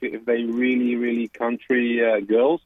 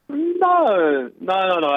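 emphatic no; 8 semitones higher, much slower than usual. Real, not acted. Caller reacting to question.

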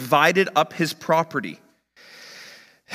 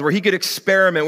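usual speaking voice

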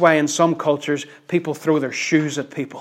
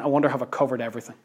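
quick, softer aside as if thinking; musing to himself; 3 semitones lower, 7 dB quieter, much faster than usual. Retold or imitated. Paul pausing after writing the verse, musing. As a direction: wonder it privately, fast and low-key. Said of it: "imagine him pausing ... and thinking".

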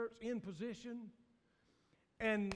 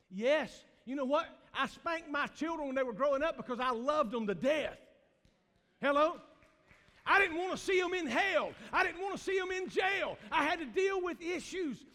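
loud, high preaching cry; preacher shouting his point home; impassioned preaching; 5 semitones higher, 6 dB louder than usual. Acted. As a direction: build into a shout.

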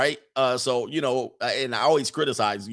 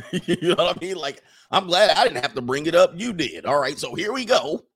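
ordinary talk, nothing marked

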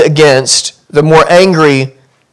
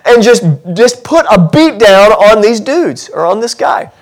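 higher, building mock rage; preacher acting out the congregation's fury, raised pitch; imagined angry outburst; 7 semitones higher than usual. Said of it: "We, we wanna say".

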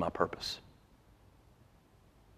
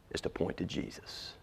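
hushed; 12 dB quieter than usual. Real, not acted. Hushed close of sermon point.

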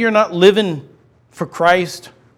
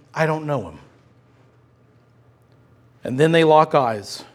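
usual speaking voice

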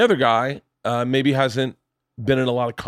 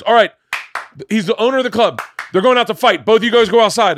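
loud, high club owner ordering them out; loud command; 8 semitones higher, 6 dB louder than usual. Retold or imitated. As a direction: bark the order to take it outside. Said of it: "Paul Lane just goes".

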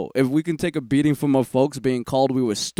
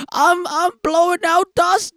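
put-on old man voice; 3 dB louder than usual. Joke about old age.

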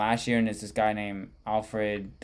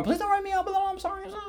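very high mock wailing plea; hammed-up crying apology; screaming, crying kid; faster than usual. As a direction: beg in an imitated tearful child's squeal. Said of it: "he starts screaming and crying even more".

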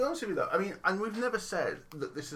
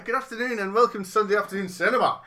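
loud, bright show opener; announcer voice; 6 semitones higher, 11 dB louder than usual. Acted. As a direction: announce it like a host. Delivering podcast opening.

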